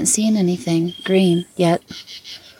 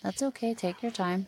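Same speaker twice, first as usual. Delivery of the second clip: soft reassuring; 2 semitones higher, 12 dB quieter than usual. Real, not acted. Softly coaxing the spirit box.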